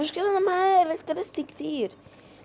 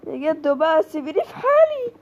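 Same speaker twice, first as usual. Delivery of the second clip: pleading whine; 2 semitones higher, 5 dB louder than usual. Real, not acted. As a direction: whine to be left alone. Begging pursuer to leave her.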